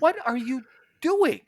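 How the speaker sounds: sharp, high, exasperated demand; retold angry challenge to the pest control guy; indignant. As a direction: confront the stranger in your room. Retold confronting the intruder.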